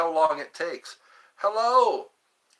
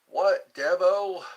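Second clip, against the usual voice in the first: normal talking voice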